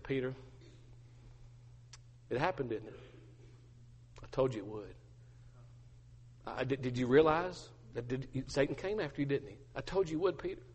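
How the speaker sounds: compassionate. Acted. Jesus looking at Peter after the denial, compassionate. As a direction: say it tenderly, with long pauses. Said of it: "it was a look of compassion".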